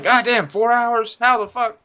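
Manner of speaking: high-pitched incredulous outburst; mock disbelief. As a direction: squeak it out, appalled by the news. Retells shock at his extra hours.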